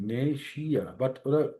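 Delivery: usual speaking voice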